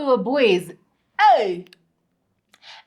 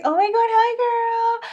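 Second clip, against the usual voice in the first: gushing, much higher sweet hi; cutesy celebrity greeting; 12 semitones higher than usual. Retold or imitated. Celebrity greets the host on the phone, gushing cutesy voice. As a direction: play the celebrity all sugary and delighted. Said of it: "it starts out cutesy".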